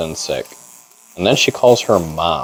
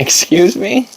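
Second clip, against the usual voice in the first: incredulous; 14 semitones higher, slower than usual. Retold or imitated. Incredulous retort in story.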